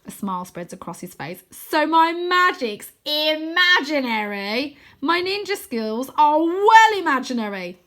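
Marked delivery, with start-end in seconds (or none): 1.5-7.8 high, loud, slow and savouring; puffed up with pride; delighted. A narrator's reading. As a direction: Wynne thrilled his magic is amazing. Wynne delighted by the compliment.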